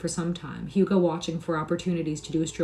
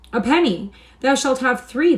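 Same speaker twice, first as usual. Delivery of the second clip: eager giving; 7 semitones higher, 8 dB louder than usual. A narrator's reading. Stranger generous outburst.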